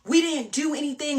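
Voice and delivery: high-pitched imitation of his defensive yelling; mock screaming back. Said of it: "He's screaming back at me".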